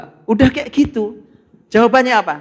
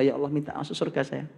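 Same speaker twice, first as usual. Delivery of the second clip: much softer, begging prayer; soft pleading voice; 3 semitones lower, 10 dB quieter than usual. Acted. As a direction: beg quietly like a man at heaven's gate. Man begging God for paradise.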